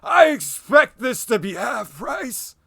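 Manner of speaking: put-on loud, slow old man complaint; grumbling old man. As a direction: huff it out like a cranky old man. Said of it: "Just kinda muttering to himself".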